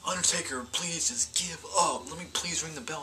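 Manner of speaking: half-hearted imitation of Shawn begging through tears; mock crying plea. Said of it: "crying throughout the entire match".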